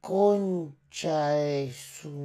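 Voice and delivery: lentísimo y bajito, sílabas alargadas; asombro en cámara lenta. Acted. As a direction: estira cada sílaba como en cámara lenta. (lisura susurrante, estirada al máximo)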